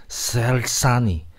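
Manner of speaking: 셀산이 slow and soft on the 스; 셀산이 slow with a gentle s; soft s sound. Shown as a demonstration. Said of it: "부드럽게 스 이렇게 갑니다".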